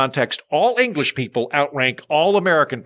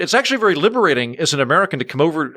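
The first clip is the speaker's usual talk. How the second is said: usual speaking voice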